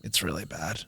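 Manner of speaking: very hushed, low pronouncement; dramatic foreboding. Retold or imitated. Psychic delivers bad tarot news, very hushed and grave. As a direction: sink almost to nothing, mock-ominous. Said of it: "she gave drama".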